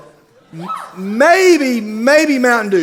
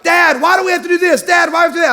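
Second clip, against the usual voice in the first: put-on high, whining kid voice; mock whine of the girls at Dad; whiny daughters; 8 semitones higher, faster than usual. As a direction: imitate the daughters complaining. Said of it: "my girls be like".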